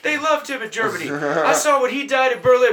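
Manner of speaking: loud, high-pitched exasperated argument; incredulous rant. Retold or imitated. Continuing retold rant about [name].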